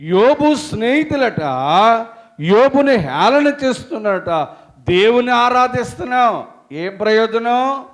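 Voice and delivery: loud, high fervent sermon voice; full-force preaching. Acted. Preacher retelling Job's friends to congregation, fervent. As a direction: preach it out with fire.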